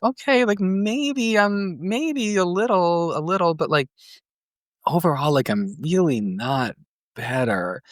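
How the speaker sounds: high, whiny, hesitant mock patient voice. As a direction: imitate your younger self pleading with the doctor, voice pitched high. Retelling pleading to the annoyed doctor.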